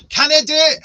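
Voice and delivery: loud, high burst of Scots; mock Scots accent. Host joking about being asked for accents, mock Scots accent. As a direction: exclaim it in a put-on Scottish accent. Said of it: "ask me to do a Scots accent".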